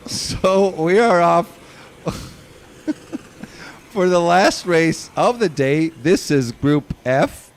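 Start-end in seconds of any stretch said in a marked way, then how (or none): none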